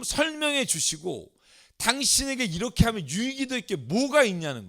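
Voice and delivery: high-pitched mock grievance against God; indignant challenge. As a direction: argue it out, pitched well up, as an aggrieved Job. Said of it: "이 따지는 게 뭔지 아시겠죠". (높은 목소리로 억울한 듯 따지기)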